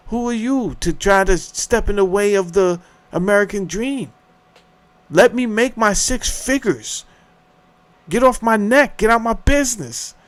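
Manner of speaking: defiant indignant outburst; fired-up rant. Real, not acted. Ranting about American dream.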